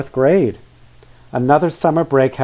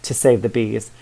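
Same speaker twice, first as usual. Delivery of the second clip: ordinary delivery, nothing marked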